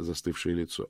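ordinary delivery

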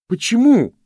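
high, loud, breathless shout; anguished cry. A narrator's reading. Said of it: "задыхаясь, проговорил он". (отчаянный высокий крик)

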